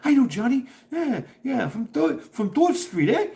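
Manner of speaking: mock New York girl, bright and chatty; high put-on New York accent gossiping. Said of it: "mimicked her accent".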